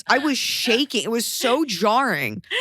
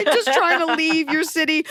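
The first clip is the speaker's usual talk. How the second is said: laughing plea; 8 semitones higher than usual. Retold or imitated. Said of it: "You're like".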